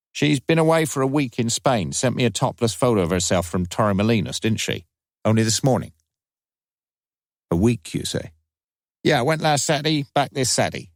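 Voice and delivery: lightly voiced common young woman, cocky; a brash girl on the phone, lightly played; Friday Banks voice. A narrator's reading. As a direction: streetwise young woman, mildly cheeky.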